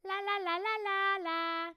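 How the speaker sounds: put-on high, loud piercing little girl la-las. Shown as a demonstration. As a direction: sing like a little girl with ponytails. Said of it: "a little girl with two ponytails that says".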